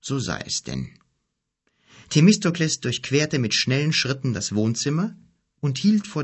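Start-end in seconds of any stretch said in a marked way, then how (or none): 0.0-0.9 much lower, softer, solemn; the old wizard accepting the boy's will. A narrator's reading. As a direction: give in gravely, fading low. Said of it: "wizard solemn".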